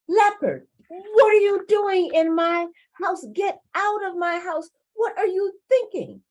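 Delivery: high, loud indignant outburst; indignant rabbit. Acted. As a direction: scold an intruder out of your house. Rabbit confronts intruder in house.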